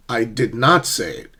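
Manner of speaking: firm denial with "not" punched; stress on NOT. Shown as a demonstration. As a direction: hit "not", falling to the end. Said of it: "the speaker is denying".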